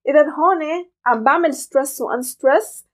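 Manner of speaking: normal talking voice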